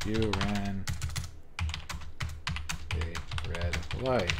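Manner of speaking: words dragged out slowly while typing; drawn-out read of the command; slow, typed-out read. Shown as a demonstration. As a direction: spell it out word by word, slowly. Typing the officer's line.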